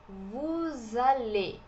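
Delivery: Vous allez very slow, the S linked as Z into allez; slow liaison demo. Shown as a demonstration. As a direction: draw out Vous allez as vu-zale. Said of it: "ligação de som com som de Z". (Vous allez bem lento, ligando com som de Z)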